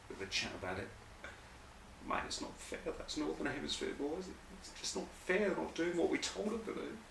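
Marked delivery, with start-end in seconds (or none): 2.1-7.0 high, quiet mock grumble; a soft, high put-on moan of unfairness; aggrieved whine. Southern rugby bosses griping over drinks, aggrieved whine.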